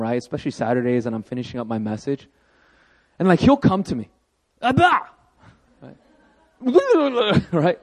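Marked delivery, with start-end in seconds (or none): 4.6-5.0 put-on high, loud child's cry of Abba; toddler calling dad. Son running up to his dad, excited child call. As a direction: call out like an excited little boy.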